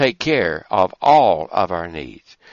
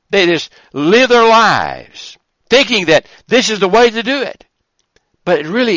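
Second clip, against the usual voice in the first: higher and louder, driving the point home; fervent preaching; 8 semitones higher, 5 dB louder than usual. Acted. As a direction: preach it with rising fire. Preaching rises to full force.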